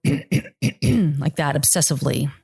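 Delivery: ordinary delivery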